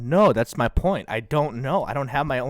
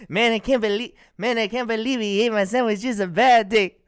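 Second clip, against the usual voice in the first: silly character voice; 9 semitones higher than usual. Acted. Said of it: "give me a character".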